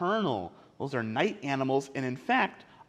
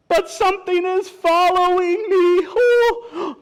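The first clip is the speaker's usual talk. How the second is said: high, loud, wailing story character; scared wolf crying out; panicked wolf; 13 semitones higher, 9 dB louder, slower than usual. Acted. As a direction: wail it in hammed-up fright. Wolf says it is being followed.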